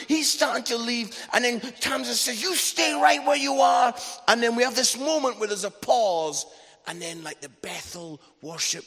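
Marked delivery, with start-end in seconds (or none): 2.4-3.9 loud, high imitation of a wife's command; shouted order. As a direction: act out a mum stopping someone from leaving. Wife's order in acted quarrel.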